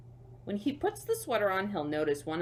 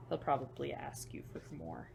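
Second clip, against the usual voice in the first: whisper; 4 semitones lower, 7 dB quieter than usual. Real, not acted.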